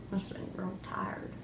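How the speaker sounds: hushed whisper. Pretend. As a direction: whisper it under your breath. Kids playing newscast.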